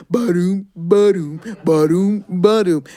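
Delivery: rhythmic ba-doom footsteps; chanted bear footsteps. Acted. Brer Bear's steps approaching, rhythmic chant. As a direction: stomp out heavy steps in rhythm.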